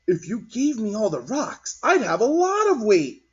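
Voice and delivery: put-on a small boy boasting; child voice. A narrator's reading.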